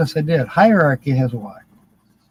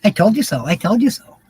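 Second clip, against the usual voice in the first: [name] impression; 6 semitones higher, faster than usual. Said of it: "Sounds like [name]".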